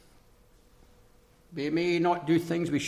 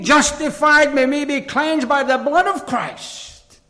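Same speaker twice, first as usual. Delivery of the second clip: raised, ringing pulpit delivery; forceful preaching; 11 semitones higher, 10 dB louder than usual. Real, not acted. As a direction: preach it out high and loud.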